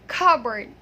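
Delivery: "cupboard" as CUB-erd, loud and falling; "cupboard" with short schwa vowels, no "board"; correct pronunciation. Shown as a demonstration. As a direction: drop the p in cupboard. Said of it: "Yes, you heard it right".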